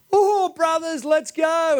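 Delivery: high, hammed-up excited call; mock joyful greeting. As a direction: whoop it out like a pretend reunion. Imagined joyful reunion greeting acted out.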